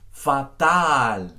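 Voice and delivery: fatal with two open ah vowels; both A's as pure ah; Spanish fatal. Shown as a demonstration. Teacher models Spanish fatal for student, open ah vowels.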